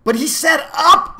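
high, loud, drawn-out whine of protest; bitter complaint. A narrator's reading. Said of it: "Milo complained bitterly".

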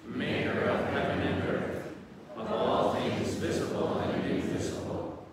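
slow, steady prayer recitation; recited creed. Acted. Priest leading the congregation in the Creed, slow solemn recitation. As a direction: recite it as a solemn act of faith.

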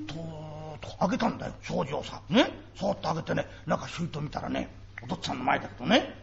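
put-on soft, secretive child's telling; hushed storytelling. Child hushed to father, telling about mother's room. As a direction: drop it low like a secret. Child whispers creeping open door.

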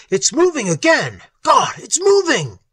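high, loud cry of alarm; alarmed shout. A narrator's reading. A man in the car seeing the creature move, shouting in alarm.

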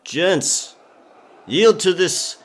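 a captain shouting down to her crew, yielding; calling out to the crew. Acted. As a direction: bellow orders over the ship.

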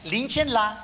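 mock Japanese. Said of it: "Suegra en japonés".

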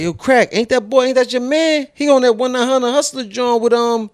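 high, excited street call-out; eager friends telling him to go get down; hyped-up homies. Retold or imitated.

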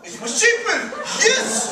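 high, loud, laughing gloat. Acted. Landlord teasing Paul about gravel, gloating laughter. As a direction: laugh in his face.

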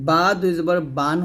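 বা with both lips shut for b, ending বান; closed-lip bā. Shown as a demonstration. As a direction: press lips together on বা and বান. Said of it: "বা দুই ঠোঁট মিল করে". (বা দুই ঠোঁট মিলিয়ে)

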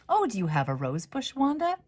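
rising high at the end; a lightly voiced kind librarian; librarian's voice. A narrator's reading. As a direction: librarian gently asks a girl. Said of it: "asked Miss Jones".

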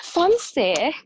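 surprised, laughing echo; amused surprise. Real, not acted. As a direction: toss the word back in amused disbelief. Amused at being called fancy.

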